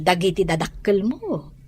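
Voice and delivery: normal talking voice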